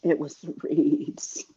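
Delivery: whispered echo. Acted. Savoring how she read the queen, a whispered repeat. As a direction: whisper "It was the reeds." with no voice.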